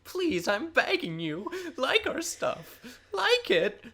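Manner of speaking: high, whiny, begging pleading. Pretend. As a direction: beg like a whining child. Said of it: "I'll stop with the whiny voice".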